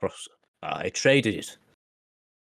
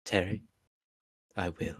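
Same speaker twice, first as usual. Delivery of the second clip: tender soft promise; 2 semitones lower, 7 dB quieter than usual. Acted.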